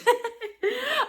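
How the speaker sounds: high bright laugh. Real, not acted. Vlogger laughing to lift the mood after tears.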